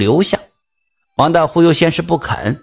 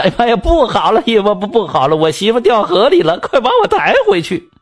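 high, fast, panicked cry for help; frantic high shout rising to a shriek; panicked yelling; 7 semitones higher than usual. Acted. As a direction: yell up high as if your wife just fell in the river. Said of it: "又在轿子里又喊叫".

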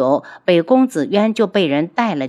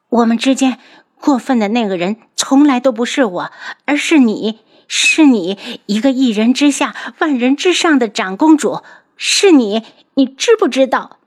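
higher and louder, hammering each 是你; heated accusation; 6 semitones higher, 4 dB louder than usual. Acted. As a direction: throw every charge back at her. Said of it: "言辞激烈". (言辞激烈地控诉，声音高而响)

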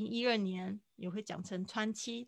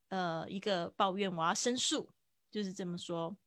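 ordinary delivery

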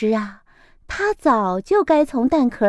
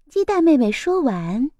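plain reading voice

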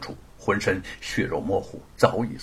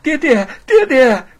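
put-on high, loud child calling daddy; joyful child's cry; 13 semitones higher, 10 dB louder than usual. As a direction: shout happily as a little boy. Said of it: "欢快地叫道". (孩子欢快地高声叫爹爹)

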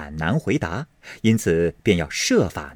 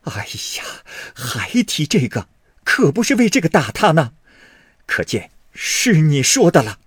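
anxious exasperation; 6 semitones higher than usual. A narrator's reading. Mother and sister to careless son, urgent exasperation. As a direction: cut him off in alarm. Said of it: "急得说道".

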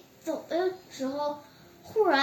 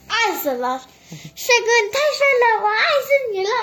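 put-on higher and louder, swooning over the handsome boy; gushing fangirl squeal; 9 semitones higher, 8 dB louder than usual. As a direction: squeal it like a smitten fan. Girl gushing over her boyfriend.

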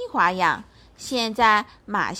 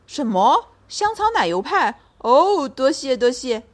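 put-on deaf old bear; 3 semitones higher, 5 dB louder than usual. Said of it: "老熊好像有点耳聋".

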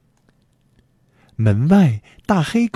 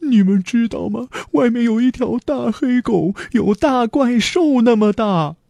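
put-on high, scared boy's voice; alarmed warning; 9 semitones higher than usual. A narrator's reading. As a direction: report the danger in a high wail. Frightened boy warns the family.

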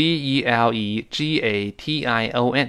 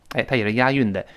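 normal talking voice

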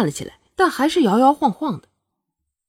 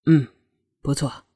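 absent-minded; 7 semitones lower than usual. A narrator's reading. Prince answering Shuangyue's question about fruit, absent-minded. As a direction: answer with your mind elsewhere. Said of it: "凌风心不在焉地说着".

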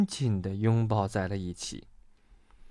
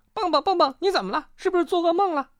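very high, worried questions; alarmed high-pitched voice; startled friend; faster than usual. A narrator's reading. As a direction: fuss anxiously over your friend. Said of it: "跳跳也被吓醒了".

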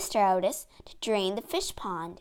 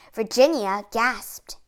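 plain reading voice

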